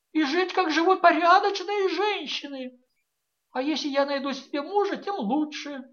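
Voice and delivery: high, loud desperate pleading; pleading daughter. A narrator's reading. A daughter begs her mother to leave with her, high and pleading. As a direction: beg like a frightened girl. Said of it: "войдя вдруг в свою роль".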